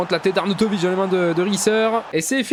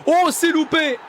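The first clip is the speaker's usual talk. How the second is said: excited cry; 6 semitones higher than usual. Real, not acted. Penalty missed in shootout.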